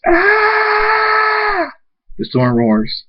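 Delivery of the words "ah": long, piercingly high scream. A narrator's reading. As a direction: hold one sustained acted scream. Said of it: "Thunderstorm screams".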